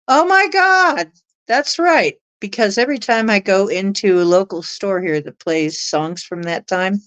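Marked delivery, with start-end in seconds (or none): 0.1-2.2 high, drawn-out exclamation; slow, high burst of surprise; retold astonished recognition. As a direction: jump up in pitch, re-enacting the realization.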